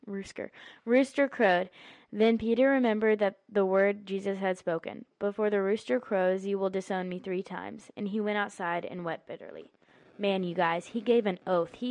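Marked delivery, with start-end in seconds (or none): 0.1-0.5 rooster breaks into a laugh; soft giggle on rooster; laughing at a slip. Real, not acted. Tongue trips on rooster. 0.9-9.7 louder, deliberate Bible reading voice; young girl reading scripture aloud to her audience. Acted. Reads Matthew 26 aloud.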